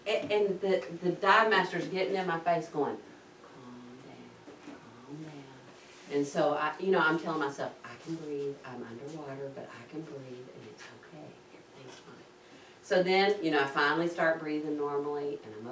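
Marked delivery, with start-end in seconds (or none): 3.4-6.2 very soft, low reassurance; hushed soothing. Retold or imitated. Dive master getting in student's face underwater, hushed soothing. 7.8-12.2 very soft, low murmur to oneself; calm self-talk. Retold or imitated. Nervous diver telling herself she is safe, hushed calm. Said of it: "just trying to be calm".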